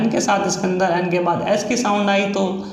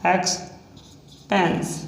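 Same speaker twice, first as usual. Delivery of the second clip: stretched slowly and a bit softer; expense drawn out long and softer, syllable by syllable; slow dictation; 4 dB quieter, much slower than usual. Shown as a demonstration. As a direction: drawl it out as you write. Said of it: "drawn out while writing".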